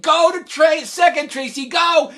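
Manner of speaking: loud high urging yell; coach's shout. Retold or imitated. First base coach shoving Tracy toward second, shouting. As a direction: push her on with your voice.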